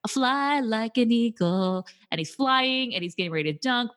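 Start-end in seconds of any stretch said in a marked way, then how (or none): 0.1-1.9 slow, drawn-out, higher quote; grand slow quote. Retold or imitated. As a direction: stretch it out like a movie hero. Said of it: "is by f".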